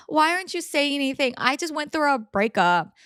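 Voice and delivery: imitating a friend's self-pitying sobs; mock crying victim. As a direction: play the self-pitying bestie, hammed up. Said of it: "She's gonna start crying like".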